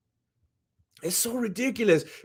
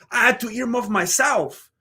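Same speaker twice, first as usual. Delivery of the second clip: ordinary talk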